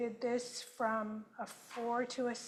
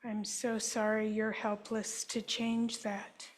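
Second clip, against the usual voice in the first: guided healing affirmation. Acted. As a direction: offer each apology gently, like a therapist. Statements for group to repeat.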